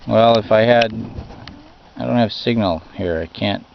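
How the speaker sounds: ordinary talk, nothing marked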